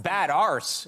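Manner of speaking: bad arse said loudly with a long 'ah'; imitated British pronunciation. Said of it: "is what it sounds like".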